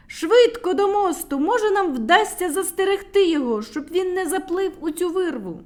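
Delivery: high, loud, hurried call; urgent shout. A narrator's reading. Medunytsia loudly urges the little ones to the bridge. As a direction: push them to hurry. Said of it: "кричала медуниця".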